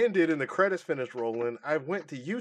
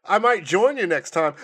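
loud, higher mock character voice; retold hero cameo; 5 semitones higher, 10 dB louder than usual. Co-host re-enacting a film cameo, loud hammy voice. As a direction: announce it big and hammy. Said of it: "he's like".

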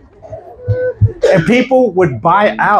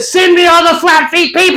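calling out; 9 semitones higher, 3 dB louder than usual. Retold or imitated. Pastor summoning people from the stage, calling out. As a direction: call it to a whole crowd. Acted announcement over stage microphone.

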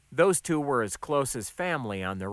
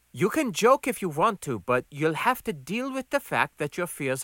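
higher-pitched admonishing woman; Gonda's voice; 6 semitones higher than usual. Acted. Gonda tells Max to snap out, higher voice. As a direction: scold firmly in a raised voice.

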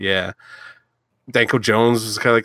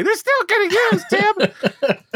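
squeaky cracked voice, much higher; mock voice crack; much slower than usual. Shown as a demonstration. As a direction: crack the voice up high on purpose. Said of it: "Oh, my voice just cracked".

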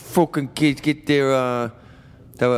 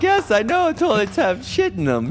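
mocking audience member; 12 semitones higher, slower than usual. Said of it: "they're like".

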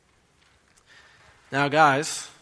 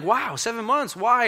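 high-pitched, astonished reaction; acted incredulity, pitch leaping up; amazed questioning; 9 semitones higher, 4 dB louder, slower than usual. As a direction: imitate a customer stunned by a deal. Debtors astonished by the offer.

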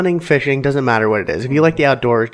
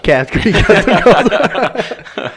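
slow, laughing through the words; can't get it out for laughing; 8 semitones higher, 4 dB louder, much slower than usual. Real, not acted. Breaking up laughing mid plug.

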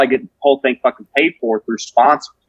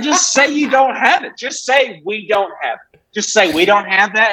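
high-pitched comic exasperation; exasperated pleading; 8 semitones higher than usual. Retold or imitated. Customer to a clerk who denies the cigar exists, exasperated.

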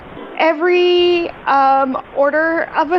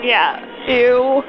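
ordinary talk

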